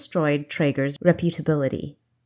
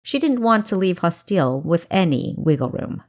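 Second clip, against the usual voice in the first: ordinary delivery, nothing marked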